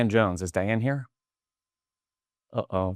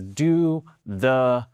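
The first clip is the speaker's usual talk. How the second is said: very slow, drawn-out reading; big bold announcement; much slower than usual. Acted. Speaker reading stadium Jumbotron aloud, big and slow. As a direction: stretch out each word like a giant sign. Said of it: "big, bold letters on the Jumbotron".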